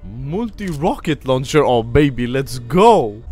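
loud, hyped-up gamer; excited cheer. Real, not acted. Player thrilled by a multi-rocket launcher, excited cheer. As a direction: build to a loud, drawn-out cheer.

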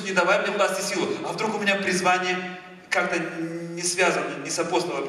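ordinary delivery

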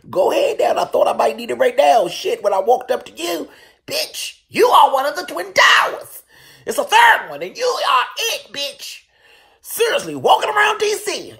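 high, loud, slowed hype with a laugh; excited shout. Retold or imitated. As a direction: yell it like he's a tower. Hyping up the huge bodyguard.

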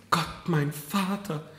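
high, slow cry of dismay; startled exclamation. A narrator's reading. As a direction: call out as realization strikes. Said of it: "rief die Marquise".

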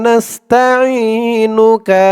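drawn-out chanted Arabic recitation; slow recitation. Shown as a demonstration. Teacher reciting the dua slowly for students to repeat. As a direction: recite it slowly, stretching each vowel.

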